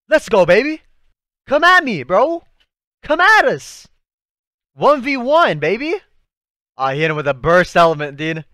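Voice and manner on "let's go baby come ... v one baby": loud, high challenge shout; hyped battle taunt. Real, not acted. Fan challenging the hero's enemy to a duel, shouted taunt. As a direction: call the enemy out at full voice.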